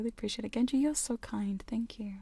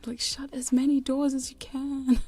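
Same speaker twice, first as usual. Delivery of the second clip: slower, louder call, higher; 5 semitones higher, 6 dB louder than usual. Real, not acted. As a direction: call across the house, slowly. Calling to wife about doors.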